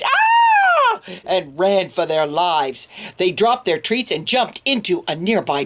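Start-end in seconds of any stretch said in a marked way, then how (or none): 0.0-1.0 very high, loud shriek; dogs' scream. Acted. Frightened dogs screaming at the monster, loud and high. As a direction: scream in terror. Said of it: "The dogs screamed".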